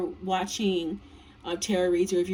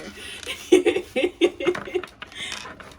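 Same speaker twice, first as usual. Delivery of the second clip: voiceless rising giggle; airy amused giggle; breathy giggle; 8 semitones higher than usual. Real, not acted. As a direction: let a breathy laugh bubble up. Laugh after joke.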